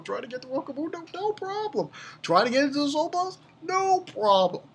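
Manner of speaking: used car salesman. Acted. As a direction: hawk it like a car dealer, questions fast, answers high. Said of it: "Like a used sales car salesman".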